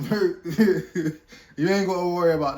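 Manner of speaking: real loud laugh spilling into the words; laughing it off, sure of the comeback. Real, not acted. Laughing to [name] that [name] will reply fast.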